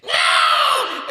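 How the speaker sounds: mock shout. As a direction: wail it in mock horror. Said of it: "Cisco's like".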